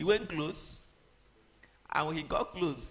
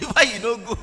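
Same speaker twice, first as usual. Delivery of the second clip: louder and higher, hammed-up scolding; mock indignant challenge; 7 semitones higher, 10 dB louder than usual. Preacher scolds Moses for not going, hammed-up loud. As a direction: rebuke him half in jest, raised voice.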